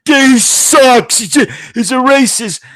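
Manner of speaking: high, put-on voice of a scornful critic; mocking critic voice. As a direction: sneer it like a whiny hater.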